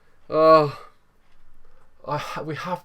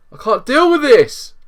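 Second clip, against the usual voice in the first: slow, high, loud cry of despair; exasperated; 10 semitones higher, 9 dB louder than usual. Real, not acted. Gamer despairing after conceding again. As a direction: wail it out in despair. Going two down.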